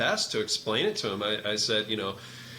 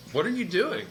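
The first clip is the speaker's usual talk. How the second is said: sharp, much higher mock demand; abrupt demand; 9 semitones higher than usual. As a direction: snap the question like a startled client. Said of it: "he can have an abrupt tone sometimes".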